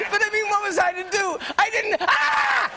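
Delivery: hammed-up royal wail at the end; high wailing princess imitation. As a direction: Princess Margaret breaking down over her marriage, in a high hammed-up wail. Princess Margaret quoted, crying out.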